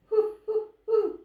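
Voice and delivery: high strained hoo calls; failed owl hoot. A narrator's reading. Owlet tries to hoot after losing her voice, strained. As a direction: strain for a hoot that won't come. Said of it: "she could not hoot".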